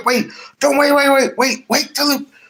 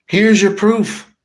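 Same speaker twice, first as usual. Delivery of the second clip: ordinary delivery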